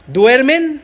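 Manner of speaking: reproachful one-word question; "¿Duermen?" as a disbelieving question; question tune. Shown as a demonstration. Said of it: "Signo de interrogación".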